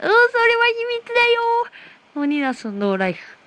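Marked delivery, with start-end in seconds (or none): 0.0-1.4 very high, playful sing-out; coy put-on squeal. Streamer coyly keeping a secret from viewers, high put-on voice. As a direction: dodge the question in a high cutesy voice.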